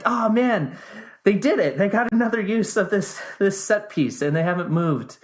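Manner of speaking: mock-thrilled at the reused set; excited. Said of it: "I'm like".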